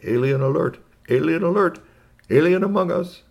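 loud, high alert call; urgent storybook alarm announcement; alarm broadcast. Acted. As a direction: announce it like a warning siren, rising each time. Recorded radio alarm from conglomerate.

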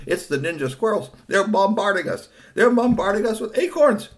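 high, loud cry building in urgency; alarmed shout. Acted. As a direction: raise the alarm as if under bombardment. Acted alarm under acorn attack.

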